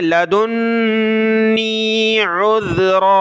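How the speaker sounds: लदुन्नी उज़्रा with hard doubled n and tongue-out dh, slow; stressed noon. Shown as a demonstration. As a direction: press the n in लदुन्नी hard and drawn out. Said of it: "नून को सख्त करना है".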